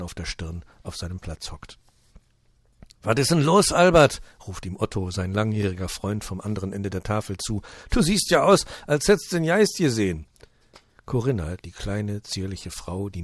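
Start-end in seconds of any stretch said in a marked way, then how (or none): 3.0-4.2 loud, high call across the table. Acted. Otto ruft quer über den Tisch. 7.9-10.3 put-on higher, louder old friend's voice; teasing old pal, lightly voiced; a hint of a jolly old man. Otto teasing a pale friend.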